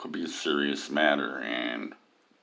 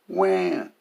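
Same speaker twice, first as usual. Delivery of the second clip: high, hammed-up baby cry; a mocking crybaby wah; 12 semitones higher than usual. Mocking a friend's grief post with a crybaby wah.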